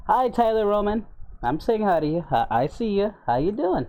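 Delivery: high, bright hero greeting; Goku voice. Acted.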